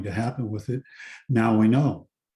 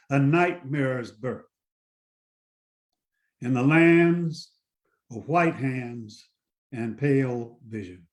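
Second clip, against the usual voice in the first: poem reading; 4 semitones higher than usual. Acted. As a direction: read it like a grave warning, line by line. Reads his own poem aloud.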